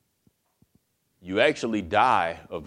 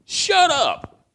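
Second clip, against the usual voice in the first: sharp high loud shut up; loud angry rebuke; 9 dB louder than usual. Acted. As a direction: snap at the other thief. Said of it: "He rebuked him".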